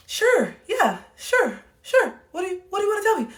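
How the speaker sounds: very high, strained-casual "sure"; forced okay act. Retold or imitated. Woman trying to show up better for her friend, forced okay act. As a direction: overdo being fine in a squeaky-high voice. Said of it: "I'm trying to act like it".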